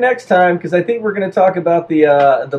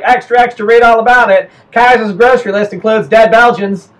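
high, loud mock newsboy; newsboy propaganda cry; 5 semitones higher, 6 dB louder than usual. Worsening propaganda about the Kaiser, high loud newsboy call. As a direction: belt out the headline like a paperseller. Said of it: "the propaganda just got worse and be like".

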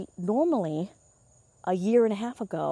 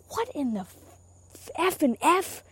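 high, drawn-out mock outrage; irritated rage; 9 semitones higher, slower than usual. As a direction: act out incredulous rage, held back in volume. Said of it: "irritated rage".

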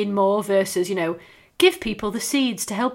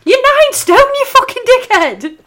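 much higher, loud, incredulous shout at the screen; exasperated yell; 13 dB louder than usual. Retold or imitated. Yelling at Bridget Jones on the TV, outraged shout. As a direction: yell it at the screen like she's lost it. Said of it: "And you're like".

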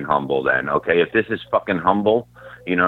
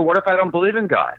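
mock indignant question; 12 semitones higher, faster than usual. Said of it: "I go".

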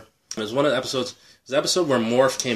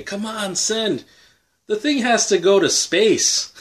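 impatient pleading; 9 semitones higher, 3 dB louder, slower than usual. Retold or imitated. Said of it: "we're waiting".

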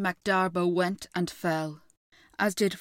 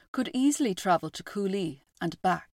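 usual speaking voice

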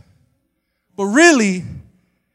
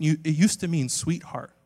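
ordinary delivery

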